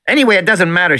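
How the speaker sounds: put-on high bratty girl voice; girl's voice. A narrator's reading. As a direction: voice the little girl, dismissive. Voicing Lex.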